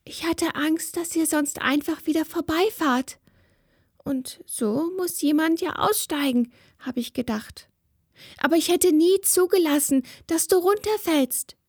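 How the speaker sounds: put-on a child explaining herself quickly; justifying herself. A narrator's reading. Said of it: "rechtfertigte sie sich".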